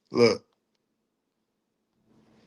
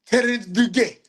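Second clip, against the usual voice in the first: high-pitched, louder French caricature; comic French pronunciation, pitched up; mock French accent; 7 semitones higher, 5 dB louder than usual. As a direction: say it as a hammed-up Frenchman. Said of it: "it's a French".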